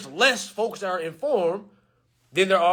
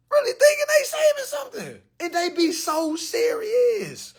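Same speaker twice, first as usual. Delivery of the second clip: squeaky high laughter through the words; laughing; 13 semitones higher than usual. Real, not acted. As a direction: barely get the words out for laughing. Still laughing from scene.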